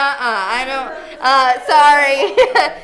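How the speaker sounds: slow, giggly "sorry"; laughing it off; mock refusal through laughter.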